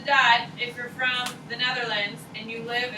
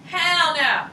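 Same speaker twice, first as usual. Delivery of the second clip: higher and louder repeat for the class; emphatic repeat; 6 semitones higher, 7 dB louder than usual. Real, not acted. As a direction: throw the phrase back at the students.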